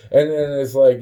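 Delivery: usual speaking voice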